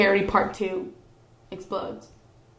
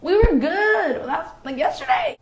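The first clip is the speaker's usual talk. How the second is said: baffled protest; 10 semitones higher, 3 dB louder than usual. Retold or imitated. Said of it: "But I'm like".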